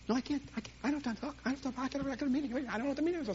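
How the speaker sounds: high, rushed excuses; flustered busy pastor. Retold or imitated. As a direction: babble excuses while hurrying off. He mimics his own hurried excuses.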